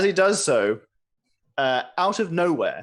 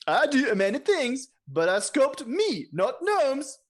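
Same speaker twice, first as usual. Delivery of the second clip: king voice; 9 semitones higher, slower than usual. Acted. GM voicing the king NPC.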